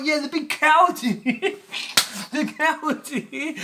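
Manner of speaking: high, loud laughing taunt; boy telling the girls his brother hides, laughing; mocking laugh. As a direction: rat out the hiding brother, laughing.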